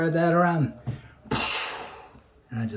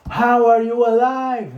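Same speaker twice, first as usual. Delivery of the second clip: much higher, louder and slow, stretched disbelief; astonished mock quote; 10 semitones higher, 13 dB louder, slower than usual. Mimicking a friend amazed someone survived, slow loud disbelief. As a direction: ham up the shock as if he saw a ghost.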